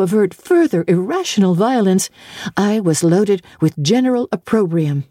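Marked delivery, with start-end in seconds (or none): none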